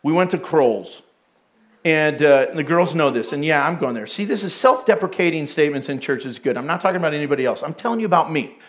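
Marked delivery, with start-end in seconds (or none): none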